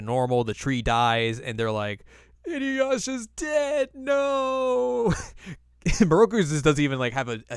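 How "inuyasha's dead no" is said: very high drawn-out fake wail; hammed-up despair, stretched and high; mock wailing grief. Friends think Inuyasha is dead, mock high wail.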